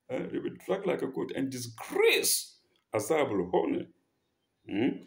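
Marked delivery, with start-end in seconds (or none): none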